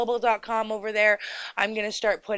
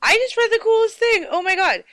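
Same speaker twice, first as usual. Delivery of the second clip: bursting with excitement, much higher and louder; excited; 12 semitones higher, 6 dB louder than usual. Real, not acted. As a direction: gush like you just found treasure. Excited news discovery.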